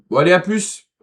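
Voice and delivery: ordinary talk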